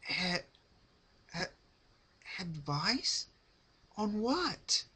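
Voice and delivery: high, breaking stutter; stammering fear. A narrator's reading. Rarity stammering at her captor, high and frightened. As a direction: choke out each syllable in terror.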